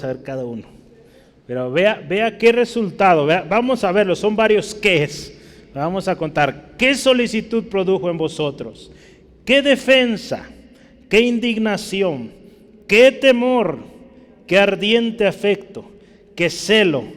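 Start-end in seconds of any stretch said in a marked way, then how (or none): 1.5-6.7 higher and louder, urgent preacher's call; preaching fervor. Acted. Predica con fuerza creciente. 6.8-17.0 each "qué" punched, much higher and louder, slowed; preaching at full force. Acted. Lee el versículo predicando.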